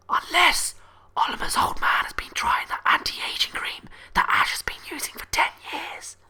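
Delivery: hushed, voiceless stage whisper; mock whisper. Gossiping about old man Olimar's anti-aging cream, stage whisper. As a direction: whisper it like a scandalous secret.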